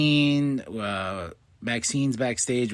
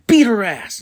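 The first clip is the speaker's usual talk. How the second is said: higher, louder barked command; commanding order; 8 semitones higher, 7 dB louder than usual. Retold or imitated. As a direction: order it like a boss. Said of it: "Then she tells Kairi".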